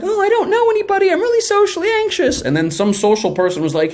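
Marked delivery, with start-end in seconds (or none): 0.0-2.4 high, timid mock voice of a shy kid; mock anxious newcomer. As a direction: squeak it out like a nervous newbie at orientation. Mocking the anxious listener.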